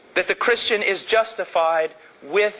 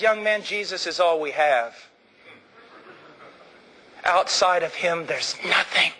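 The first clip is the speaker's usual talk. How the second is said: sober correction. Acted. Preacher correcting an excited young man, soberly. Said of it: "And I said".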